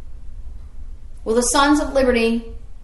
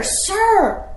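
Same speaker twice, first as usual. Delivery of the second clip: higher, slow, put-on scolding voice of a guard; sentinel scolding; 6 semitones higher, much slower than usual. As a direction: scold the noisy rider, drawn out and pitched up. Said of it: "yelling at, at Revere".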